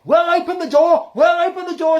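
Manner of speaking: high, loud determined shout. A narrator's reading. Maskelyne shouting to Dorcas, high and loud. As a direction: yell it twice to push on. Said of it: "shouted Maskelyne".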